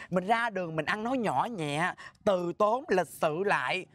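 slow, measured lecture, pitched low; slow, gentle admonishing. Acted. Scolder teaching a rude rival to speak politely, slow admonishing. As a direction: spell out good manners slowly and patiently.

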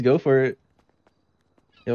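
ordinary talk, nothing marked

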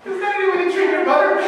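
high, quick scolding question. Retold or imitated. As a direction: tell the son off. Scolding the son.

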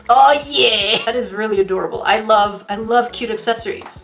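normal talking voice

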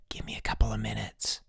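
soft, secretive whisper. A narrator's reading. Girl asks Bruno to wait below, whispering. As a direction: whisper it down from the window. Secret night talk at window.

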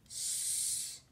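S as a long voiceless sss; hissed S sound. Shown as a demonstration. Teacher showing children a starting sound, S hissed softly. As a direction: say S as a soft, sustained hiss. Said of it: "with the S sound".

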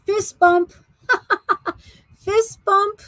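high, excited cheer broken by laughter; playful cheer. Real, not acted. Seller cheering a viewer who shares her birthday, playful and excited.

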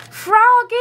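high, drawn-out mother's call; long singsong holler. A narrator's reading. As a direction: call a child in from far away. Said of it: "his mother called".

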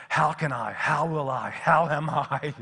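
loud, insistent volley of questions; mounting overwhelm. Acted. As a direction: pile the questions up, stretching the last. Piles up overwhelming how questions.

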